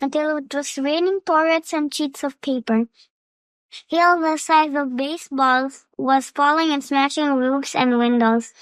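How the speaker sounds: plain reading voice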